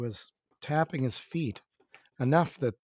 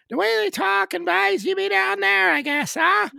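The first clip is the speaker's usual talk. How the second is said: put-on high, loud rural Newfoundland accent; 10 dB louder than usual. Host shows cohost how Newfoundlanders talk, high Newfoundland accent. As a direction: put on an Irish-sounding Newfoundland voice, pitched way up. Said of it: "it's an Irish sort of accent".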